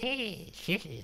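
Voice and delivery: high-pitched, hissing cartoon devil laugh; high hissy devil snicker. Shown as a demonstration. Showing how he imagined the devil, high hissy snicker. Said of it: "high-pitched, hissy kind of a soy boy voice".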